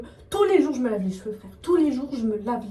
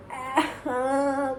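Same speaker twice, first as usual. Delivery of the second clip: mock reaction cry; 2 semitones higher than usual. Vlogger previewing her after-appointment self, mock drawn-out cry. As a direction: act out a small pretend wail. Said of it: "la vidéo de moi juste après en mode".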